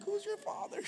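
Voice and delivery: the preacher re-imitates the biker, in a hammed-up squeak; exaggerated biker imitation. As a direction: push it way up into a comic squeak. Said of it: "I'm like".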